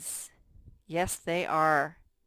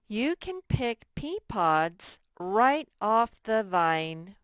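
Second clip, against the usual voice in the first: slow emphatic reading; 3 semitones higher, 6 dB louder, slower than usual. Shown as a demonstration. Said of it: "teacher reads model sentence".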